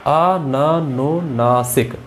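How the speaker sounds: अननुनासिक stretched syllable by syllable, very slow; slowly drawn out. Shown as a demonstration. Teacher slowing the word down for students, very slow. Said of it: "यहाँ अनुनासिक है या अननुनासिक है".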